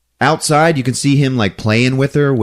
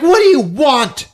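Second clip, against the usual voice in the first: startled; 4 dB louder than usual. Retold or imitated. Thunderbutt grabbed by Marcus.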